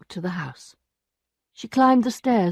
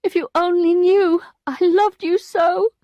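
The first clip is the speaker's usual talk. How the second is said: Emma pleading; 11 semitones higher, 6 dB louder than usual. A narrator's reading.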